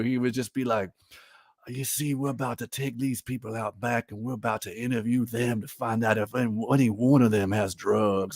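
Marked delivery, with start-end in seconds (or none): 1.7-8.4 soft, drawled mock Cajun accent; bayou accent. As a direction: mimic Seagal's fake Louisiana bayou accent, quietly. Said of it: "as if he ... in the bayou".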